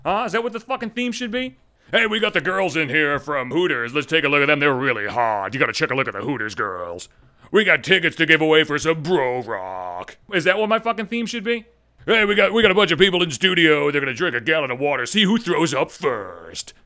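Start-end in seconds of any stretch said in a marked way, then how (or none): 1.9-10.2 cheesy radio DJ voice; hammed-up shock-jock patter; mock morning zoo DJ. 12.1-16.7 cheesy radio DJ voice; hammed-up shock-jock patter; mock morning zoo DJ.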